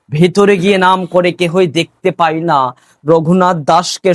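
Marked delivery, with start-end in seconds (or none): none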